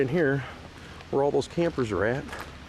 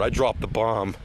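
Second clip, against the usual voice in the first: normal talking voice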